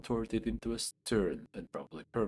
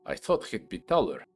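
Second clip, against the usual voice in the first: louder, dismissive scoff; 8 dB louder than usual. Acted. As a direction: sneer at the small cleric. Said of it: "he says with a scoff".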